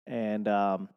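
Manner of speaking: normal talking voice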